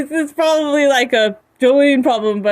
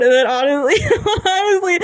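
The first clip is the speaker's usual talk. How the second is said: ordinary talk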